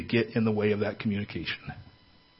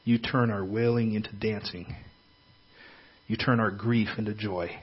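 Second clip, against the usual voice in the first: soft, earnest prayer voice; 4 dB quieter than usual. Acted. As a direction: pray quietly and earnestly. Leads a prayer.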